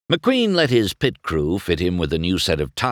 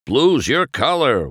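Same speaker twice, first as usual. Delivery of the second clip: higher, louder chirp of flattery; piped up; 8 semitones higher, 6 dB louder than usual. A narrator's reading. Crewman flatters his boss, piping up. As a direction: pipe up eagerly. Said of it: "one of the pitties piped up".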